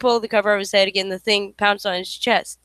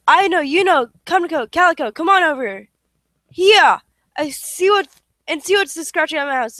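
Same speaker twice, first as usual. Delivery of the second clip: high, loud calling out; loud call to the dogs; 6 semitones higher, 4 dB louder than usual. Acted. Smee calls his dogs.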